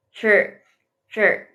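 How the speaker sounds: che said as che, not I; Mandarin zhi sound. Shown as a demonstration. Teacher explains how to read ZHI aloud, che. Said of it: "pinginnya dibacanya pakai che".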